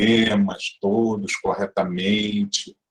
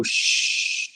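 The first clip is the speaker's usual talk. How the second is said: "chi" stretched slowly on the breath; drawn-out chi; 4 dB quieter than usual. Shown as a demonstration. Teacher demonstrating slow release on chi. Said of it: "e solta fala".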